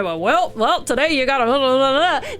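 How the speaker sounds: teasing imitation of a friend's dad voice; hammed-up dad impression; mock dad impression. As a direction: put on a blustering dad voice, trailing into blah-blah. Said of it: "That's the exact voice you make when you talk like your dad".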